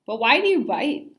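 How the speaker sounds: loud, puzzled grandma. A narrator's reading. Grandma teasing Simon with a riddle, loud and playful. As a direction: pose the odd question playfully.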